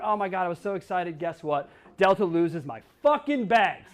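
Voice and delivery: ordinary delivery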